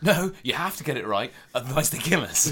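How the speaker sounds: high, loud, hammed-up desperation; mock panicked chef. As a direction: plead urgently with the kitchen staff. Acted panicking chef in deadly kitchen.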